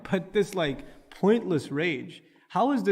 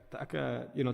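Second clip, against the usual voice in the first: usual speaking voice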